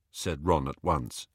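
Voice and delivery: plain reading voice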